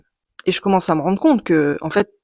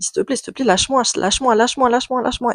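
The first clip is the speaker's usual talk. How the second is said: lightly imitated tearful begging, higher; retold pleading; 5 semitones higher than usual. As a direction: plead a little higher, a mild hint of tears. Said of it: "je me mets à pleurer". (supplication rejouée, un soupçon de larmes, plus aiguë)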